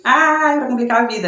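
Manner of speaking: ordinary talk, nothing marked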